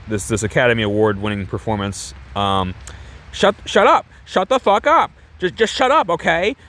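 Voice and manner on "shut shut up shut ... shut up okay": high-pitched, exasperated failed tough guy; hammed-up angry actor. Man trying to dump his fling, hammed-up high exasperation. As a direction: badly act tough, voice pitched way up. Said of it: "my best impression of him in this scene".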